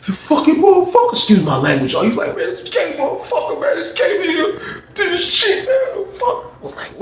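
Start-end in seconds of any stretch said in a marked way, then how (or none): 0.1-1.1 high, whiny swearing; imitated victim's outrage; mock aggrieved cursing. Imitating injured man cursing. 2.4-6.5 very high, softer whine; hammed-up whimpering complaint; mock tearful outrage. Imitating injured man cursing.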